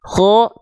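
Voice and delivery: "Kho" heavy, leaning toward O; full, heavy "Kho"; thick pronunciation. Shown as a demonstration.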